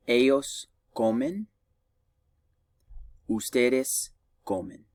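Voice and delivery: slow conjugation drill; slow teacher drill. Shown as a demonstration. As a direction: pause for class to repeat. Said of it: "repeat after me".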